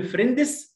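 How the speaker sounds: Egyptian-style فريندز with a vowel after the D; Egyptian pronunciation. Shown as a demonstration. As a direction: say فريندز as two syllables, 'fren-dis'. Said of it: "دي مصري".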